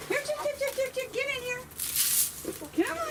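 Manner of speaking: very high coaxing call; calling chickens. Real, not acted. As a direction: coax the hens in, way up high. Herding chickens into coop.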